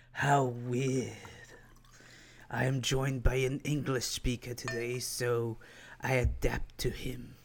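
a hint of a creepy Sith lord greeting his guests; Darth Sidious impression. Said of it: "a Darth Sidious, impression for some reason".

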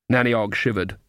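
plain reading voice

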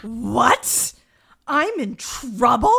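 loud, high, drawn-out disbelief; mock astonishment. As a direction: play it utterly flabbergasted, slowing on the second question. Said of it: "he's utterly astonished".

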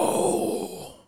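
very high drawn-out yowl; creature's scream. Retold or imitated. Creature screaming from the forest, high eerie yowl. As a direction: let out a long imitated screech. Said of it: "all they hear in the forest is".

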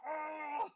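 high shouted mock yelp; retelling the kid's pained cry. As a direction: yelp like a kid hit on the head. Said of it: "He's like".

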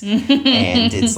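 slow squeaky high voice. Retold or imitated. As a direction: stretch it out in a squeak. Mocking the customer service voice.

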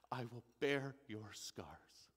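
low, soft and slow divine voice; Yahweh speaking tenderly. Acted. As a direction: comfort him slowly, barely above a murmur. God answering the thief.

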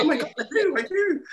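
high gasp of sudden realisation; mock shock at catching herself; realising, surprised. Mum realising she has an English accent, high surprised squeal. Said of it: "I was like".

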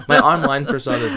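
talking through laughter; a real laugh on every word. Real, not acted. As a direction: still laughing while getting the words out. Laughing at own persona name.

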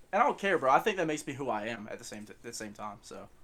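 ordinary talk, nothing marked

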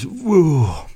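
big high yawn sliding down; mock yawn. Tired truck driver yawning beside passenger, exaggerated high yawn. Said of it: "immediately starts yawning".

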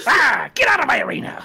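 high, angry claim of turf; territorial shout. Acted. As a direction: yell it as the arena's owner. GM voices attacking character.

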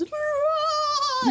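very high, drawn-out mock scream; imitated flying-away scream. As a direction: scream like someone punched skyward. Imitating punched villain flying off.

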